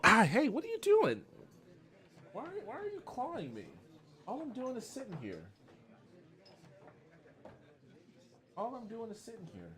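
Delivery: soft, very high voice for talking to a pet; high, hushed, quick fussing at a pet; high-pitched pet talk. Real, not acted. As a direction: squeak a startled, gentle complaint to the pet. Talking to the pet clawing them.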